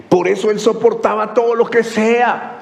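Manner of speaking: high, impassioned pulpit proclamation; soaring, fervent sermon climax; full-force preaching. Acted. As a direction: cry out the verse to the congregation.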